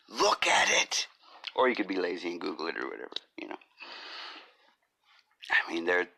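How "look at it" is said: high, strained comic command; mock outburst. Podcaster urging listeners to see it, high outburst. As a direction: burst out high, demanding they look.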